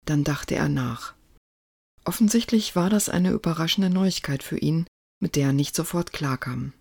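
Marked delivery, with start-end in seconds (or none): none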